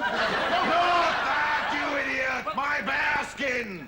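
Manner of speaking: furious shout. Acted. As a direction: roar at the idiot. Officer loses temper.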